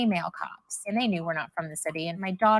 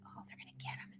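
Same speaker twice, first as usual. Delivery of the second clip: whisper; 22 dB quieter than usual. Retold or imitated. I'm, like, all nervous.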